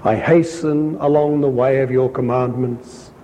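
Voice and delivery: ordinary talk, nothing marked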